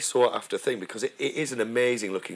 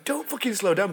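retold shout; 9 semitones higher than usual. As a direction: act out a frustrated shout without full volume. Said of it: "I kept just shouting".

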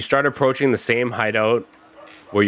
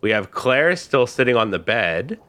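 usual speaking voice

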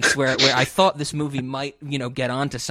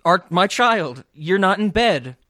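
mock parent; 4 semitones higher, 7 dB louder, slower than usual. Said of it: "they're like".

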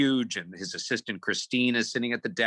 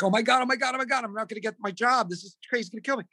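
high-pitched frantic fretting; panicking at a much higher pitch, words tumbling out; acted-out freak-out; retold panic; 13 semitones higher, faster than usual. Retold panic about losing job.

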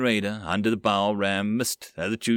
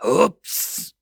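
high squeaky goblin oops; 7 semitones higher, 4 dB louder than usual. Acted. Goblin falling in sea.

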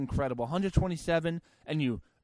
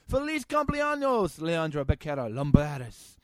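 put-on Spanish accent. Mock Spanish birthday greeting.

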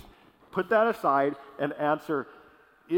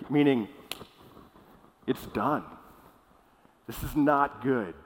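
ordinary delivery

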